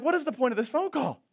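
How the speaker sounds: high, fast baffled aside; exasperated. Retold or imitated. Pastor wondering why the friend called, exasperated. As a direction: say it like you're done with this.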